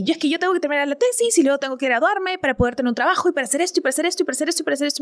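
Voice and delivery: fast breathless run of plans, speeding up; rushed list. Retold or imitated. Host retelling her rushed thesis plans to the coach, speeding up. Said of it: "Como que".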